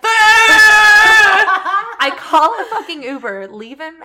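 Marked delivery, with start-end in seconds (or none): 0.0-2.0 a sudden high laughing shriek of fright; retold terrified scream, half laughing; mock panicked scream. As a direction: shriek it out like you think you're being killed. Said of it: "and I was like".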